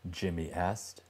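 usual speaking voice

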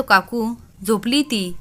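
usual speaking voice

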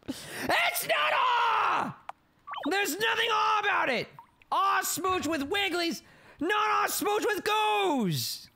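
indignant high yell. Real, not acted. Indignant protest at game.

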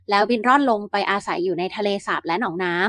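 ordinary delivery, nothing marked

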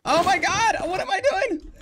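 very high, loud frightened shrieks; terrified screaming. Real, not acted. Gamer fleeing danger, terrified high screams. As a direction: scream like you're being chased.

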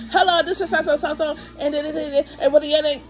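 mock-bored call center greeting, fast and high; rushed phone agent. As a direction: rattle off the phone greeting like she's in a hurry to hang up. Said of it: "That's how she sounded".

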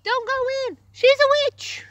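frantic shouted warning; urgent warning shout. Acted. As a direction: yell the warning to save them. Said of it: "and she said".

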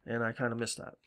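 ordinary delivery

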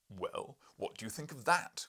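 loud, triumphant cry. Acted. Professor crowing at the narrator over the drawing. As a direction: crow it out in triumph. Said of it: "cried the professor".

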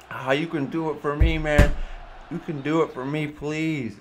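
put-on wheedling drunk. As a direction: sweet-talk him into one favor.

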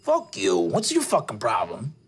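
low-voiced tough older guy; low, hostile character voice; confrontational older man. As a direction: challenge a stranger in a low voice. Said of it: "He goes".

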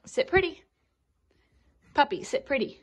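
high coaxing command. Real, not acted.

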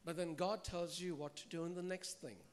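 usual speaking voice

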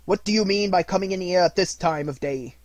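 mock gruff scolding. A narrator's reading. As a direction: play the stern boss with a wink. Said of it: "growled Scrooge in his accustomed voice".

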